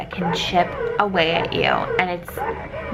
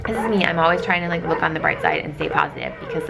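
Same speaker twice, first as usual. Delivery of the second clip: ordinary talk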